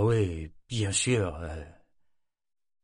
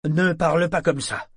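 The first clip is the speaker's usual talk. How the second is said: sharp, much higher and louder rebuke; violent exclamation; 9 semitones higher, 6 dB louder than usual. Acted. Sailor snapping at the woman he fears for, furious outburst. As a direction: bark it at her like at an unruly sailor. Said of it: "s'exclama-t-il avec violence".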